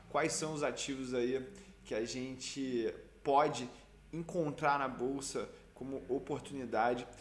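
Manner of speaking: ordinary talk, nothing marked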